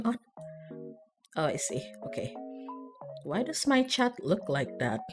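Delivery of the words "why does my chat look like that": ordinary delivery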